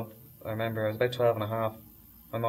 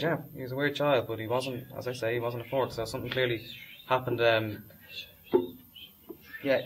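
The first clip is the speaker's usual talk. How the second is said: ordinary delivery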